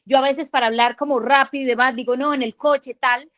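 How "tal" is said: normal talking voice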